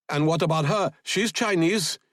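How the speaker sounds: high-pitched suspicious soldier; accusing soldier, pitched high; interrogating soldier. A narrator's reading. Soldier interrogates about the woman.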